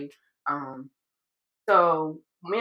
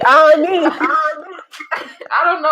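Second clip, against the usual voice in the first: laughing, slow and high; real laughter; 8 semitones higher, 7 dB louder, much slower than usual. Real, not acted. Laughing at her cohost's teasing, slow high real laughter. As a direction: laugh through the words.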